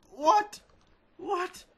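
very high squeaky disbelieving what; shocked what. Real, not acted. Stunned by the worker's haul, squeaky high disbelief.

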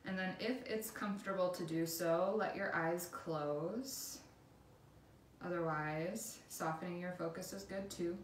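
guided meditation. Acted. Instructor leading listeners through a body scan, calm guided meditation.

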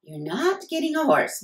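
a lightly voiced mom saying no; mother refusing. A narrator's reading. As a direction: shut it down like a tired mother. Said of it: "Mom shook her head".

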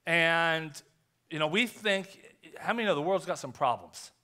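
usual speaking voice

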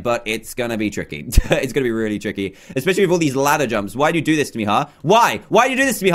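ordinary talk, nothing marked

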